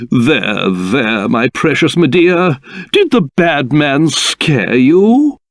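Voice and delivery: low, cooing reassurance; tender soothing. Acted. King comforting precious Medea after a scare, tenderly.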